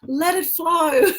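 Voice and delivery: high, loud, drawn-out cheer; playful urging. Real, not acted. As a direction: stretch it out high and loud, egging them on. Playfully urging tears to flow.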